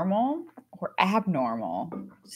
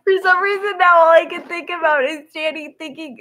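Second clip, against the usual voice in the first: high laughing sob-giggle; helpless laughter, near tears; crying laughing; 9 semitones higher, 5 dB louder than usual. Real, not acted.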